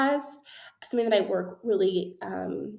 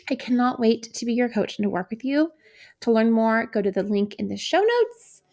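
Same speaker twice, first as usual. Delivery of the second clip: bright, pushy promo voice, a bit louder; upbeat ad read; 4 dB louder than usual. Acted. Host pitching her coaching program to listeners, upbeat ad read. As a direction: sell the coaching program with energy.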